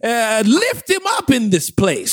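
slow gospel preacher call. Pretend. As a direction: lift it like a church preacher. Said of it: "Come on, somebody".

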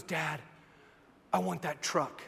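low, soft voice, imitating a little boy; soft opening plea; small child's soft request. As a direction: quietly ask Dad, as a three-year-old. Son's first request in toy aisle.

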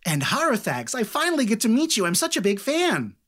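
high, excited halfling voice; starstruck, pitch climbing high; gushing fan. Acted. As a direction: gush at meeting your idol. Halfling meets her idol.

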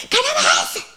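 sudden high burst; mock exclamation. Preacher mimicking an outburst, high burst. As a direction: blurt it out in mock alarm.

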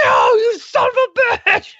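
high, slow, laughing mock curse at the dog; mock exasperation. Owner curses her dog for locking the truck, high mock exasperation. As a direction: scold the dog in comic disbelief.

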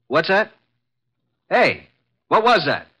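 high, loud calls down the phone; alarmed shouting. Acted. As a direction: shout to get the caller's attention. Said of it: "[name], stop shouting".

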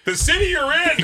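high-pitched incredulous shout; mock exasperation. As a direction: cry out as if it's obvious. Acted exasperated reply to receptionist.

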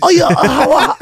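high, loud laughing mock Indian accent. Mocking a friend just back from abroad, Indian accent. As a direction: break into laughter mid-accent. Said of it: "you go to India for, like, a week".

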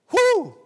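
sudden loud, high-pitched whoop; exhilarated high whoop; excited whoop. Real, not acted. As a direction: let out a thrilled preacher's "woo". Excited whoop after quoting scripture.

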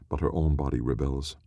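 plain reading voice

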